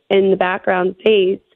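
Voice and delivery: ordinary talk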